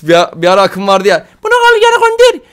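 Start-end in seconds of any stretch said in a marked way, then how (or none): 1.4-2.5 high imitation of a woman; woman's voice. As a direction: squeal it like the viral woman. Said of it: "diyen bir abla vardı".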